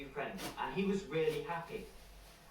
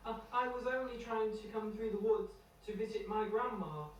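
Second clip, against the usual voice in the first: put-on much higher childlike voice, stammering; scared little boy Tom; 8 semitones higher than usual. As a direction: stammer it out high like a nervous boy. Frightened Tom explains himself.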